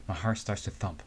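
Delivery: normal talking voice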